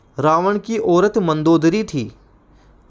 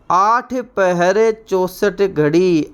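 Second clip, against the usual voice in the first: slow recitation; 2 semitones higher, much slower than usual. Shown as a demonstration. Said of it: "idiom recited slowly".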